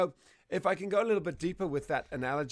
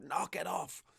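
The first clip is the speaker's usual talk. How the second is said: higher-pitched shout, held back in volume; mock shout; 5 semitones higher, slower than usual. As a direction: imitate an owner yelling at the dog. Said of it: "We shout".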